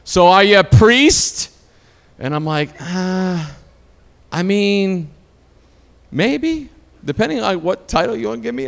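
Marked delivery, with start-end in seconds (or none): 0.0-1.5 loud, slow Boston aunt impression; louder, higher, Boston-accented demand. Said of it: "she's like, she's from Boston". 2.8-8.7 halting, higher-pitched, noncommittal reply; hesitant answer. Retold or imitated. Retells his awkward answer to the aunt.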